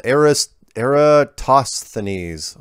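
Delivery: slow effortful try at "Eratosthenes"; drawn out "Eratosthenes", each syllable spelled out; careful slow attempt. Shown as a demonstration. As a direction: sound out Eratosthenes slowly, syllable by syllable. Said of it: "one of these days I'm gonna get that thing down".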